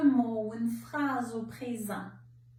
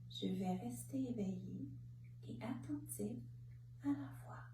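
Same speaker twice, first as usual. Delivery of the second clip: much softer, as if thinking it; hushed repetition; 11 dB quieter than usual. Shown as a demonstration. Guide repeating the phrase to say mentally, hushed. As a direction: let the mantra sink inward, very quietly.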